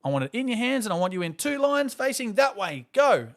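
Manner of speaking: high, loud barked orders; imitating a strict teacher. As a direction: order the kids into lines. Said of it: "The teacher was quite strict".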